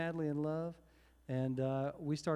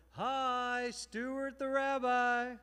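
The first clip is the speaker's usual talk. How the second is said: higher, slow, drawn-out friendly greeting; rabbi impression; 9 semitones higher, 3 dB louder, much slower than usual. Rabbi greeting a newcomer at the door, slow high hello. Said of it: "here's that impression that he loves of himself".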